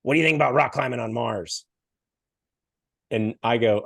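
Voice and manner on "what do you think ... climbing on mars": lightly nasal, pinched question; a hint of a nasal stranger. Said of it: "Nasally voice goes".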